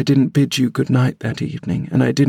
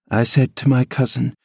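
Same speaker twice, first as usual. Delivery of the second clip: ordinary delivery, nothing marked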